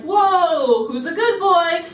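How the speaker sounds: put-on high, loud nervous baby talk with a laugh; startled, half-laughing coo at a hissing squirrel; startled pet talk. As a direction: back off laughing, talking to a pet. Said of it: "stepped back and was like".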